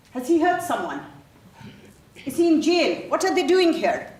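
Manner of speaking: high, loud, panicked questions; alarmed. Acted. Mother seeing a police car outside her house, panicked. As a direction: voice jumps up in mounting alarm.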